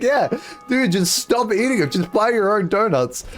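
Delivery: high-pitched mock scolding; exasperated roommates. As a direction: imitate housemates who are fed up. Roommates scold in retold argument.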